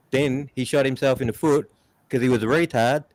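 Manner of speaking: ordinary talk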